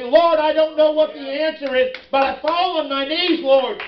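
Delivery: preacher acting out a desperate plea; crying out to God. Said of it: "cry out to God".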